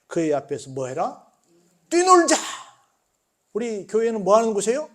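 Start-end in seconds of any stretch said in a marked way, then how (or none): 1.9-2.4 high, loud joyful cry; rally everyone to jump and play; exuberant rallying call. Acted. Preacher calls the church to leap before God, exuberant.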